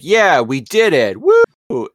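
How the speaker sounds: celebrating, rising into a high, loud woo; triumphant cheer. Acted. Co-host acting out Bob's victory, triumphant cheer. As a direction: cheer the win with a high whoop.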